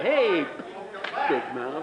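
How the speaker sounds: retold taunt, lightly acted; imitating a taunt. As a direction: tease like a schoolyard heckler. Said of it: "'Cause he went".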